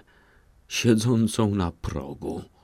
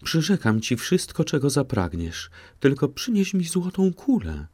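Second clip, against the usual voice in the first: much higher, pleading eagerly; eager calling; 9 semitones higher than usual. A narrator's reading. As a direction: beg for the ball, voice lifted high. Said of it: "zawołała królewna".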